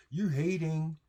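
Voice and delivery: high mocking echo of a stock line; mocking quote. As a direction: throw their phrase back with scorn. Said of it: "sounds like the parroting shit".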